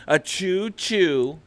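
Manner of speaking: high, loud, drawn-out train whistle; playful train imitation. Hyping the co-host's market sense, playful train whistle. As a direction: toot it like an approaching train. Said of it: "You hear the train a coming".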